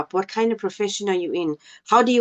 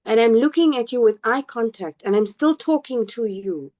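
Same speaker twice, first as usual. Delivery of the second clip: ordinary talk, nothing marked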